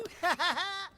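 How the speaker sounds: soft taunting giggle; sly bully laugh; mocking laugh. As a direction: laugh as you run off with the toy. [name] laughs while running off.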